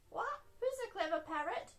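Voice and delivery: high-pitched parrot squawk and echo; parrot copying. A narrator's reading. Polly Parrot copies Granny Pig's praise, high parrot voice. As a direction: squawk it, then parrot the question back. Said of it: "Polly copied".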